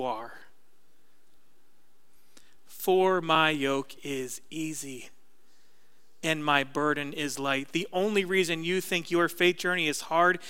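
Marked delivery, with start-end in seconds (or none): 2.7-7.7 slow, softer Jesus voice; slow soft quote. Acted. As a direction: read it slowly and gently as Jesus. Quoting Jesus slowly.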